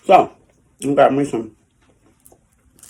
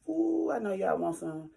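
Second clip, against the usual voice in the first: ordinary talk, nothing marked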